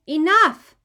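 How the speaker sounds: loud fed-up shout; furious enough; exasperated shout. A narrator's reading. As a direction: cut him off sharply. Boy silences counting brother.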